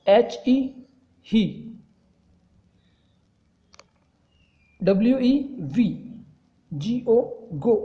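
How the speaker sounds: letters spelled out slowly, then the word; a lightly paced classroom spelling-out; slow spelling drill. Shown as a demonstration. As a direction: dictate each letter with pauses. Teacher spells out word list.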